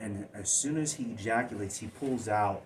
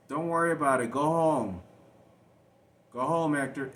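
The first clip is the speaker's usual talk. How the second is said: retold brush-off; 7 semitones higher, 12 dB louder than usual. Brother sending child away.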